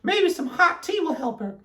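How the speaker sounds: high-pitched character voice; Amelia Bedelia. A narrator's reading. Amelia Bedelia to Alkaloo, high character voice. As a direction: say it up high at normal volume. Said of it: "said Amelia Bedelia".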